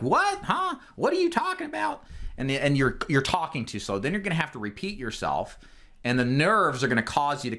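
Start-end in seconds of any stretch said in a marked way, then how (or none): 0.0-2.0 squeaky high, baffled; high bewildered questions; confused client. Pretend. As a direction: play the lost client who can't keep up. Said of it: "It's like".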